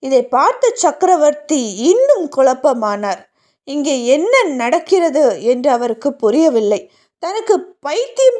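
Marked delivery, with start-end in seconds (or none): none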